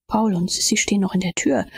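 ordinary delivery

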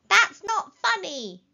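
high, slow, loud scolding; indignant protest. A narrator's reading. Startled rabbits tell off the voice, indignant.

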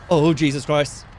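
ordinary talk, nothing marked

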